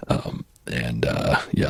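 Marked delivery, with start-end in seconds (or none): none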